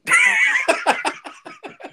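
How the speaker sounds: high loud burst of laughter. Real, not acted. Host hearing the hallway story, loud high laugh. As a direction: crack up for real.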